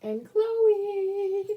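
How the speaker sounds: high squeaky excited voice; high-pitched. Acted. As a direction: sing out the dog's name. Naming the dog, high voice.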